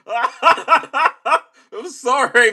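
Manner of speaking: very slow, high, laughing through the words; stretched high giggly sorry; laughing apology. Real, not acted. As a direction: apologise while still cracking up. Still laughing after phrase.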